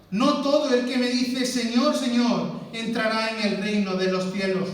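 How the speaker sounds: loud, emphatic pulpit delivery; fervent preaching. Acted. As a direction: proclaim the verse with full force. Preaching at full force.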